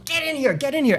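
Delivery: high-pitched, hushed-down imitation of calling someone in; urgent beckoning. Imitating producer urgently beckoning him in.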